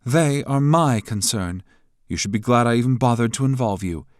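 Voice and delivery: high, loud, taunting woman's voice; mocking rival. A narrator's reading. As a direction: needle him with scorn.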